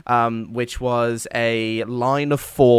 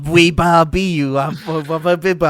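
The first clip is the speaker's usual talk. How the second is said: put-on high French baby babble; mock a stammering infant attempting French; 4 semitones higher, 4 dB louder than usual. Teasing a friend's failed French accent, mock baby babble. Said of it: "is that a French baby?".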